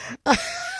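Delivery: short high giggle; laughter. Real, not acted. Host refusing to tell listeners what happened, laughing. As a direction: giggle breaking out between sentences.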